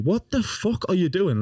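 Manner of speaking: high, slow, incredulous question; retold disbelief. Grandson confronting nana as she trashes the room, incredulous.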